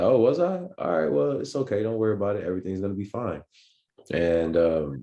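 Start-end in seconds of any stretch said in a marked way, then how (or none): 0.0-3.4 high, breezy and loud at first, settling down; hammed-up carefree denial; oblivious cheerfulness. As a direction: wave it off like nothing happened. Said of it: "And I was like".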